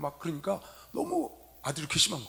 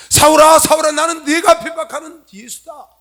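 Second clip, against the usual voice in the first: loud, high, slow call from on high; forceful divine proclamation; 10 semitones higher, 18 dB louder than usual. Acted. Preacher voicing Jesus confronting Saul, loud booming proclamation. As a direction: thunder it out slowly, voice raised high.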